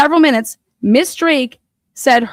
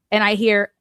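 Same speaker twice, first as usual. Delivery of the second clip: ordinary delivery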